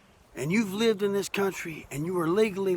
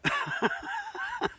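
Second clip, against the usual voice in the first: laugh; 9 dB quieter than usual. Real, not acted. Laughs after hippie self-mockery.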